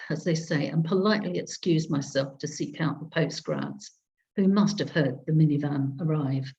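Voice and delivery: usual speaking voice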